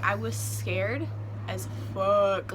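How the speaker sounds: SCARED stretched slowly for emphasis; slow, weighty repeat; drawn-out emphasis. Real, not acted. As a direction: linger on "scared", then pause before "as fuck".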